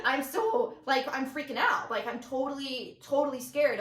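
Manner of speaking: worked-up retold panic; freaking out. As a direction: blurt it out as if panicking to a friend. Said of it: "texting one of my friends being like".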